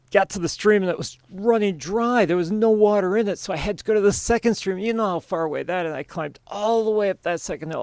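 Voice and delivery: high, rushed, overdone complaining; henpecked husband pleading his hard day; whiny excuse-making husband. Acted. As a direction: pile on the excuses breathlessly. Husband excuses himself to wife.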